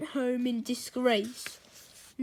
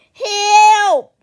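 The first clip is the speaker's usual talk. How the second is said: very loud, high scream for help; full-voiced cry of alarm; panicked scream; 10 semitones higher, 18 dB louder than usual. A narrator's reading. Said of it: "screamed Mister Nurden".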